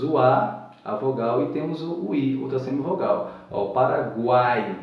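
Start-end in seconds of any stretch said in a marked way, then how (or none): 3.7-4.7 Paraguai drawn out, u, a and i all heard; slow Paraguai. Shown as a demonstration. Teacher stretching Paraguai as a triphthong. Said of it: "exemplo de um tritongo".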